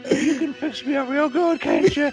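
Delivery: very high, wheedling mother voice; falling-apart Ma. Retold or imitated. Host playing decaying Ma asking her son to fix her, high mock coaxing. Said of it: "she starts to fall apart like she's some kind of leper".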